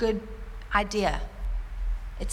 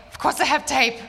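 higher and louder, almost indignant; emphatic friend; 5 semitones higher, 6 dB louder than usual. Retold or imitated. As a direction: voice the friend answering as if it's obvious. Midwife friend answers emphatically.

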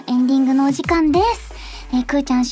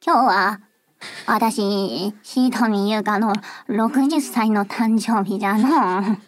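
put-on low, slow old granny voice; 6 semitones lower, slower than usual. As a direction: speak low and slow like an elderly grandma. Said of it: "すごいおばあちゃんの真似がうますぎて".

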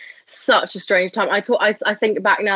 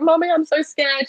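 put-on higher, slow, childlike appeal without real tears; retold tearful plea; 8 semitones higher than usual. Daughter getting into her mum's car, mock tearful plea. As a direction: imitate a scared cry to mum. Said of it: "I started crying".

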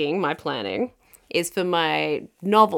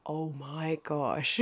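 slow, hushed awe; quiet, amazed appreciation; awed reaction; 2 semitones lower, 11 dB quieter, much slower than usual. Acted. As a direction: draw it out softly in admiration. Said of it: "you are like".

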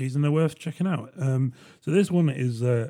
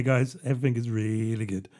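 normal talking voice

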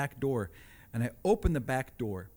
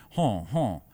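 mock stoic grunt; 4 semitones lower, 5 dB louder than usual. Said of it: "You saw Dancing with the Wolves".

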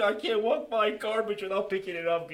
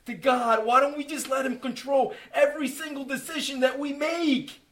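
loud, rising testimony; impassioned church testimony; fervent preaching; 4 semitones higher, 5 dB louder than usual. Real, not acted. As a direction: preach it out with full conviction. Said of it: "testimony rising to preaching".

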